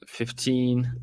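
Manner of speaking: usual speaking voice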